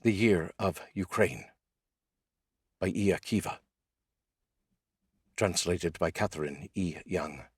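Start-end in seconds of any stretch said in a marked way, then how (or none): 0.0-3.6 poem reading. Acted. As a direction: let each grim image land in the silence. Reads a translated war poem.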